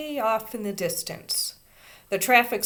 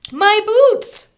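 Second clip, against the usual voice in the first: put-on high, loud child's wail; 15 dB louder than usual. A narrator's reading. Little girl wailing at the boy carrying her. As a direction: wail for the lost boots. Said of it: "she wailed".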